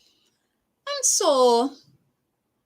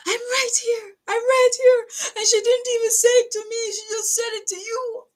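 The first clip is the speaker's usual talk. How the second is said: crying; 10 semitones higher, 4 dB louder than usual. Real, not acted. Reliving the hurt, starts crying.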